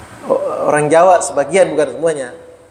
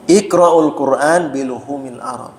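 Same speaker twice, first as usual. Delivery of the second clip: slow, measured recitation of the hadith; recited Arabic; 3 semitones lower than usual. Shown as a demonstration. Teacher recites the hadith slowly to students. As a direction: intone it slowly like scripture.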